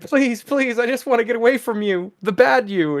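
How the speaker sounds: high, fast, desperate pleading; boy milk boy begs Jane to get away from him. Acted. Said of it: "he's like".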